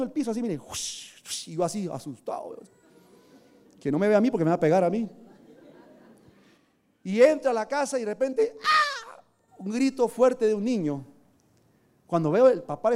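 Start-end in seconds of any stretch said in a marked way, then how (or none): 3.8-5.1 fast frightened whisper-like rush; scared rush. Retold or imitated. Preacher retelling hiding from the elder, fast and scared. 7.0-9.2 sudden high mock scream of a child; imitated child scream. Preacher imitating a child's scream from the whipping.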